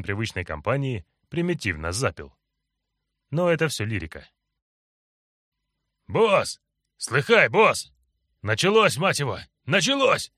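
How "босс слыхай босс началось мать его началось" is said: out of breath, gabbling urgently; breathless rush. Acted. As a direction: burst in panting and rattle it off. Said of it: "Тяжело дыша, затараторил он".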